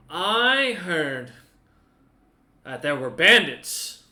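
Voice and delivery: put-on loud, slow drunken tavern voice; slurring drunk villager spilling gossip; drunk NPC. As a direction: bellow it like a tipsy drinker.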